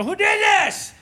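high, loud angry parent yell; acted demand at the top of the voice; retold yell. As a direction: yell it as an outraged dad. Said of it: "I started yelling".

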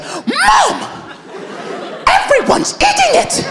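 put-on very high-pitched child's outburst; hammed-up tantrum at Mom; brother snapping. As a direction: imitate a kid losing it, voice shooting way up. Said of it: "He just snapped".

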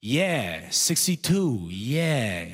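drawn-out, clueless ad-lib echo; senile older guy. Acted. Older sidekick ad-libbing after the first guy, senile and clueless. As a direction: echo him vaguely like a senile old sidekick.

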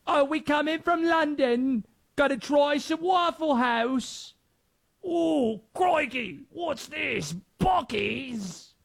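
high, hammy British tourist voice; bad British accent. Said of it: "My accents are awful".

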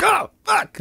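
high-pitched mock fright; startled reaction. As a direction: imitate a driver caught off guard. Acts out startled driver.

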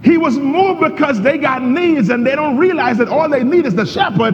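a sermon at full force; fiery preaching. Acted. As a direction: drive it home to the church.

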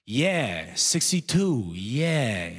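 put-on vague old man chiming in; senile older guy. Older guy ad-libs after his partner's directions, senile. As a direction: echo along like a clueless old sidekick.

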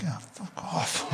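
hushed, drawn-out whisper; under the breath. Retold or imitated. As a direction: mutter it unvoiced, barely audible. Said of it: "like that, under my breath".

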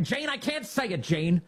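high whiny put-on voice, half-laughing; mock pleading candidate. Candidate begs Jane to stop, high mock whine. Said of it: "He's like".

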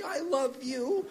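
very high, soft, slow mom voice; imitated doting mom. Mom on the extension to her son, imitated high soft cooing. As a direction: coo it high and slowly, lifting at the end. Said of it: "you hear her pick it up".

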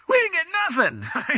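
high-pitched comic grumble with a laugh; mock complaint. Exhausted crew griping about a fruitless night, high mock complaint. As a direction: whine it high, half laughing. Tired crew's complaint, acted out.

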